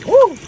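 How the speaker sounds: short, high, loud whoop. Real, not acted. Reader reacts to the cards, high whoop. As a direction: let out a sudden high "woo". Sudden whoop during reading.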